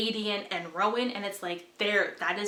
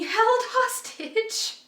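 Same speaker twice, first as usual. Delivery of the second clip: ordinary delivery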